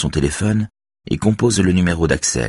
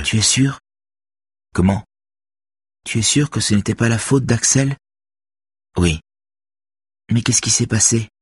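put-on higher girl's voice, earnest and firm; teen girl voice; 6 semitones higher than usual. A narrator's reading. Girl defends Axel to Robert, higher earnest voice. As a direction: voice the young girl defending him. Beverly's voice.

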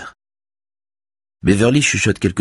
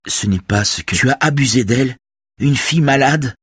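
shouting in anger; 9 semitones higher than usual. A narrator's reading. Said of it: "crie Robert".

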